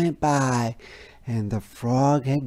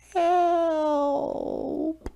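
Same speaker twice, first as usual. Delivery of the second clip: feeble groan. A narrator's reading. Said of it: "he groaned feebly".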